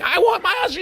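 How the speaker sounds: very high, hammed-up screech; mock screaming. Wife demanding her husband, mock screaming. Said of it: "she starts screaming".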